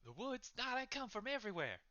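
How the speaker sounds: high, disgusted patron's rant; high-pitched exasperated character voice; fed-up anger. Acted. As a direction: complain bitterly, ready to storm off. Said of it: "And he just storms off".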